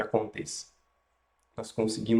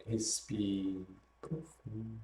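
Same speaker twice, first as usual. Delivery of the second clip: guided meditation; 3 dB quieter than usual. Acted.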